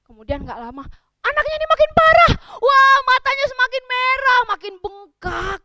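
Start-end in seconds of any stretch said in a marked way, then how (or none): 1.2-5.6 high, loud storyteller's cry of alarm; dramatic, high-pitched alarm; alarmed outcry. Acted. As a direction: cry out in dismay, easing on the last words. Alarm at baby's worsening eyes.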